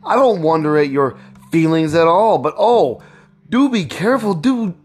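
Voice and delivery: high-pitched anxious wife; fearful, beseeching appeal to a husband; frightened pleading. A narrator's reading.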